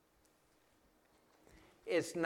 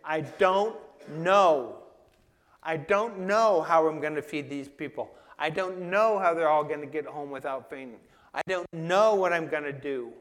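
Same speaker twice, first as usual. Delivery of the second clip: louder, worried pleading; anxious prayer; 2 semitones higher, 8 dB louder than usual. Retold or imitated. Believer voicing worry in a hard situation, pleading. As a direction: fret aloud to God. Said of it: "and we say".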